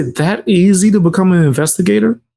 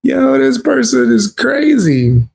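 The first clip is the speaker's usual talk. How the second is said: incredulous; 9 semitones higher than usual. Real, not acted. Reacting to clip, voice jumps.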